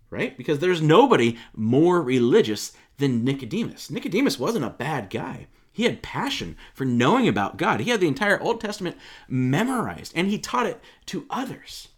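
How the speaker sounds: ordinary talk, nothing marked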